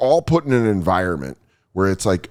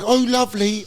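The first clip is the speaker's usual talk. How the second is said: imitating a coworker's fake British accent, pitched higher; a high, put-on posh British Oh, lovely; mock British accent; 11 semitones higher than usual. Waiter mimics the hostess greeting, in a faked British accent. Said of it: "she had a British accent".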